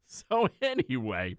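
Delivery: ordinary delivery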